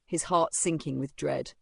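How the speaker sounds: plain reading voice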